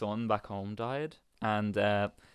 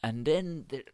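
imitating Dublin accent; 5 semitones higher, 4 dB louder, slower than usual. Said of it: "thick Dublin accent".

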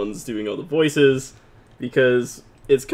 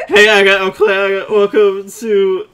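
show intro; 5 semitones higher, 6 dB louder than usual. Acted. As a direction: announce the show like a host. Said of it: "I was gonna do an introduction".

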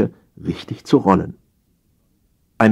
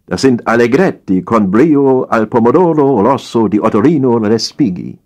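higher, louder, grand Italian flourish; indignant Italian waiter; 4 semitones higher, 4 dB louder than usual. A narrator's reading. Waiter grandly naming the dish, indignant and louder. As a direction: roll out the dish name like an opera title. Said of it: "wies der Kellner sie indigniert zurück".

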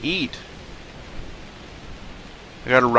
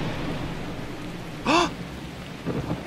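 high, airless excited gasp; relieved gasp; 9 dB quieter than usual. Real, not acted. Streamer finally spots food and gasps excitedly. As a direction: gasp at finally spotting prey. Spots prey after searching.

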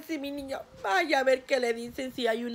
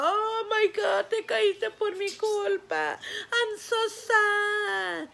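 put-on high, slow, frightened kid voice, apologizing in a rush of guilt; scared boy; 8 semitones higher, much slower than usual. The frightened friend apologizes to her son, high and slow. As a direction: play the panicked friend who caused the fall. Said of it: "el niño bien asustado".

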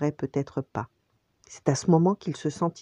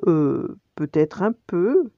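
slow, hesitant, pitched-up reply; reluctant concession; 4 semitones higher, 5 dB louder, slower than usual. A narrator's reading. Nala humouring Simba after a sigh, hesitant. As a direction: drag it out doubtfully. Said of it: "Nala soupira".

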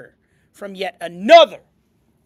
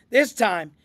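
plain reading voice

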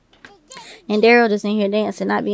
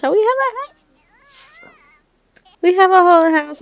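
high, squealing delight; bursting with joy at the news; thrilled to tell viewers about the house; excited; 9 semitones higher, 4 dB louder than usual. Real, not acted.

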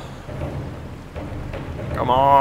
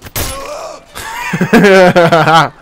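big loud burst of laughter, high; loud laughing shout; 5 semitones higher, 7 dB louder than usual. Real, not acted. Gamer bursting into loud, high laughter at the game.

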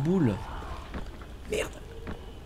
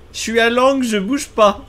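higher, louder tense excitement; excited; 9 semitones higher, 8 dB louder than usual. Real, not acted. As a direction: blurt it out in alarm. Cornered, excited.

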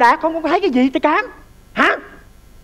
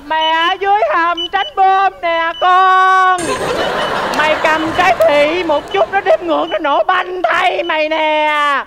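slow, stretched calling out; mother calling from the shelter; drawn-out call; 3 semitones higher, much slower than usual. Acted. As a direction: call from far off slowly. Mother calls from bomb shelter.

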